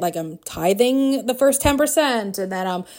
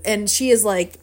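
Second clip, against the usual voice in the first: ordinary talk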